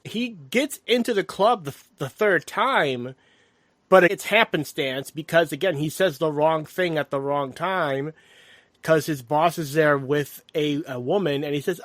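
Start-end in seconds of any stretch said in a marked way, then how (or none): none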